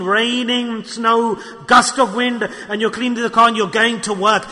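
usual speaking voice

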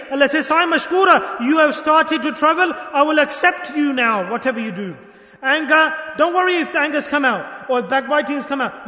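loud, emphatic sermon delivery; full-force preaching. Real, not acted. Preacher relaying God's acceptance to strivers, forceful proclamation. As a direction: proclaim it to rouse the congregation.

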